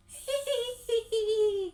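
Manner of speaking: high, loud eerie giggle; shrill-pitched "hihihi" of a spooky child; child ghost giggle. A narrator's reading. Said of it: "suara anak kecil terdengar".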